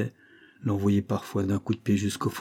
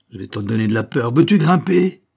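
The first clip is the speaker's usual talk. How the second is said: threatening; 2 semitones higher, 7 dB louder than usual. A narrator's reading. Banquiste threatens the frightened child, rising angry shout. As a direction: menace that erupts into a yelled order.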